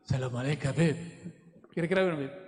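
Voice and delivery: lightly voiced stone, pitched low; a low, lightly acted greeting from a stone. (sapaan batu dengan suara rendah)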